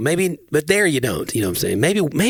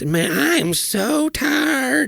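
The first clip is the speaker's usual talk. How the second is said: much higher, very slow drawl imitating wife; tired Southern drawl; 13 semitones higher, much slower than usual. Husband imitating his tired wife's Southern drawl. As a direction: stretch every word like a sleepy Southerner. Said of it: "She just turns".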